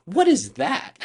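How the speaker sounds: high, loud baffled question; baffled kid. Retold or imitated. Young rookie asking coach about the award, baffled. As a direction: blurt it out, totally clueless. Puzzled kid reacts, retold with laugh.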